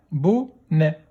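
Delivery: "Bonnet" split into bo, nè; a gap inside "Bonnet"; syllable by syllable. Shown as a demonstration. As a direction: sound out "Bonnet" in two beats. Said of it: "Deuxième syllabe".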